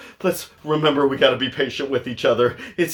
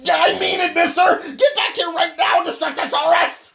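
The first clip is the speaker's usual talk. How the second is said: angry shout; 12 semitones higher than usual. Acted. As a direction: bellow it like the last warning. Parent losing patience, counting to three.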